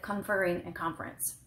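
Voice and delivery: conferring stressed on FER, conference on CON; contrasting syllable stress. Shown as a demonstration. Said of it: "Same with".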